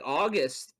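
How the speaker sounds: ordinary delivery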